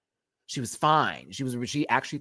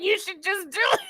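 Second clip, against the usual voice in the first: put-on squeaky-high quote; high, stretched mimicry; high-pitched mock caller. Quoting the funny caller's line.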